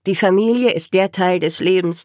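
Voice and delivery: plain reading voice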